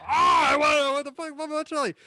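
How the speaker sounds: high-pitched yell retelling own frustration; mock-agonized high cry; retold frustrated outburst. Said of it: "I'm like".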